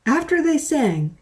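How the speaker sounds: ordinary delivery, nothing marked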